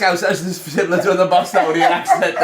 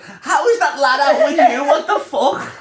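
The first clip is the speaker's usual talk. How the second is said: high, loud, slow disbelief, laughing; can't believe what he's hearing; incredulous; 12 semitones higher, 4 dB louder, slower than usual. Retold or imitated. Retelling the friend's call to his cohost, incredulous laughing disbelief. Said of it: "And I was like".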